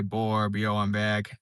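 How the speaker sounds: usual speaking voice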